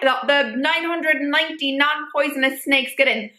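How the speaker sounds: ordinary talk, nothing marked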